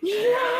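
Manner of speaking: very high, loud squealed cheer; high-pitched celebratory scream; excited squeal. Retold or imitated. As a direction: shriek it with delight. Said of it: "so excited".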